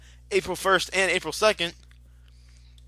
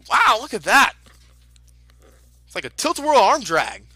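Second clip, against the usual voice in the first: excited play-by-play; 6 dB louder than usual. Real, not acted. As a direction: call the match with excitement. Live play-by-play of lucha match.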